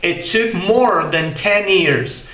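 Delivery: American English, R fully sounded; American accent. Shown as a demonstration. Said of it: "Já no inglês americano soa como". (inglês americano lento, com R)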